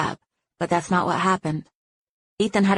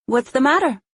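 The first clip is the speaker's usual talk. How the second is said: put-on higher, unashamedly thick Mexican accent; terrible Mexican accent; 7 semitones higher, 3 dB louder than usual. A narrator's reading. Woman teases her date, terrible Mexican accent. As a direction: ham up a bad Mexican accent. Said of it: "terrible but unashamedly thick Mexican accent".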